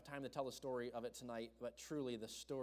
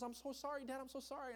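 put-on much higher, a small boy's tearful apology; crying child apologizing; 11 semitones higher than usual. Crying son apologizing to dad over bumped truck, tearful and high. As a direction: imitate the little son sobbing sorry.

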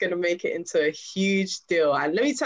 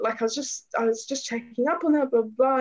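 high-pitched, flustered excuse; retold plea in a raised pitch; pleading; 7 semitones higher than usual. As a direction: imitate someone begging to be let go. Acting the cyclist pleading to leave.

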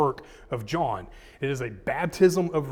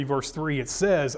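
usual speaking voice

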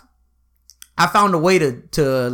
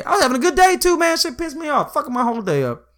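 frustrated vent; 10 semitones higher than usual. Real, not acted. Venting about the suspension.

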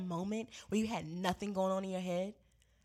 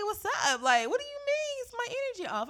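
much higher, incredulous pushback; exasperated; 12 semitones higher, 5 dB louder than usual. Retold or imitated. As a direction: throw it back in disbelief. Exasperated retelling of her retort.

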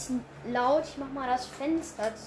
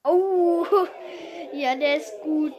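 loud burst of laughter; laughing; 2 semitones higher, 7 dB louder than usual. Real, not acted. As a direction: laugh out then say it. Reacts to joke laughing loudly.

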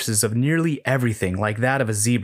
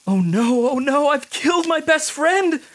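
high, panicked wail; horrified realization; 3 dB louder than usual. Acted. Thinks he killed friend.

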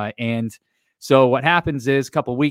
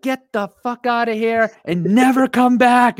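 slow, much higher mock scream; screaming mom; 12 semitones higher, 3 dB louder than usual. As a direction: imitate mom throwing him out, high and loud. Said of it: "And she's screaming".

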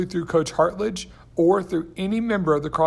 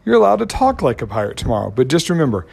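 loud excited; 7 dB louder than usual. Real, not acted. Hyping up pirate day.

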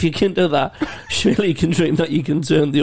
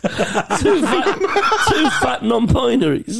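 laughing bingo call, higher; bingo caller; 10 semitones higher, slower than usual. Retold or imitated. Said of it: "bingo calling".

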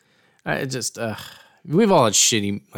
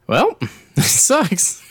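laughing mock voice; 12 semitones higher, much slower than usual. Voicing the secretary, laughing.

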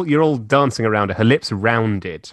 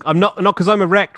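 higher, louder quick protest; 10 semitones higher, 6 dB louder, faster than usual. Real, not acted. Reader protesting to Rach, loud quick denial. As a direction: insist fast and loud.

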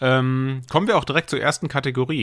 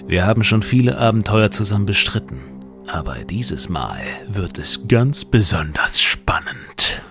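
dramatic trailer voice; 3 dB louder than usual. Acted. Trailer intro continues.

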